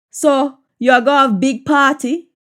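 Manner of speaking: Jamaican patois question, rising at the end. Shown as a demonstration. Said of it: "patois version of the line".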